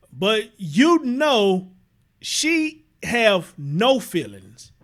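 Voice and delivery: slow, heavy stress on feelings; slow emphatic. Real, not acted. Vlogger insisting to viewers, drawn-out stress. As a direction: lean hard on no feelings.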